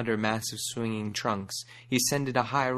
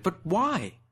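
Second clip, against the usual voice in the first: shouted question; 9 semitones higher than usual. A narrator's reading. The buck shouting his question at the warren. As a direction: cry it out in disbelief. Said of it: "a buck shouted".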